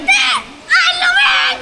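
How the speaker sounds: startled yelp at cold water; cold gasp. Real, not acted. Jumps into cold pool.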